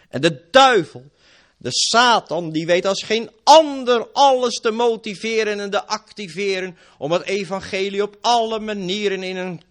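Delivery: loud, high fiery sermon; full-force preaching. Acted. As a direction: thunder it from the pulpit.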